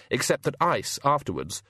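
plain reading voice

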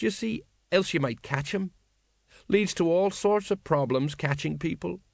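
mournful rambling story; a hint of sorry old sergeant; miserable sergeant. Colon tells of robbery.

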